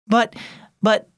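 halting, mildly stammered protest; stuttered. A narrator's reading. Grandson taking in grandpa's news, stuttering. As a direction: break off and restart, lost for words. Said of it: "Asher stuttered".

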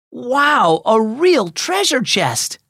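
high, slowing cry of wonder; excited discovery. A narrator's reading. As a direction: marvel at the find, pitch lifted. Boy finds a treasure box.

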